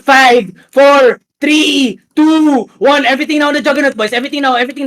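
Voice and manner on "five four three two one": loud rising countdown; posse leader shouting orders. Real, not acted. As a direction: call it out over the team. Shotcaller counting down on Juggernaut.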